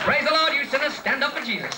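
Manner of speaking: mock preacher bellowing at the crowd; preacher shout. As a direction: call out to the sinners like a revival preacher.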